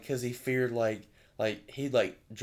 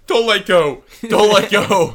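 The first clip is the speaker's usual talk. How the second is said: loud, higher urgent shout; retold pleading; 7 semitones higher, 13 dB louder than usual. Slipping friend begging the one holding him, urgent shout. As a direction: plead loudly, imitating panic.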